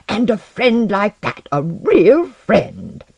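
high, slow, emphatic lament; anguished outburst. A narrator's reading. Alchemist beating his book after losing a friend, anguished. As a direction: pound out each word in frustrated grief. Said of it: "beat the open book with his fists".

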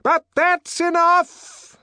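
slow, high, loud shout; calling out. Acted. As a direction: call a halt over the noise. Director calling out over the noise.

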